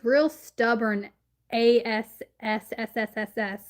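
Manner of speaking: long hissing s. Acted. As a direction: hiss the s out like a snake. Hissing out stubborn.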